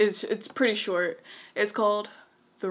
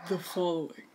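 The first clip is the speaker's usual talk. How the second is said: slightly lowered, playful title echo; mock deep voice; 3 semitones lower than usual. Reader echoing friend's title, lightly put-on deep voice.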